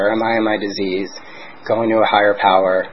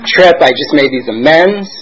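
higher, louder, pumped-up bragging; mock cocky excitement; 3 semitones higher, 8 dB louder than usual. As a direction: puff yourself up like you've already won. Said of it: "And I was like".

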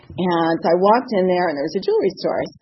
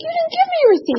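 indignant protest. Retold or imitated.

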